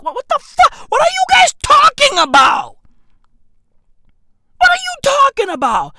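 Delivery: high, fast exasperated shout; incredulous outburst. Real, not acted. Comedian reacting to hype over a baby photo, incredulous shout. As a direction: shout it in high-pitched disbelief.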